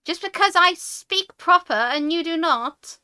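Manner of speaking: huffy rant about being called American; indignant rant. Real, not acted. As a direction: lecture them, offended. Ranting about accent.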